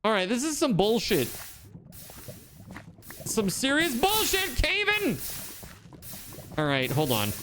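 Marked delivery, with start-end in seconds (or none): 0.0-5.2 frustrated. Real, not acted. Frustrated at game.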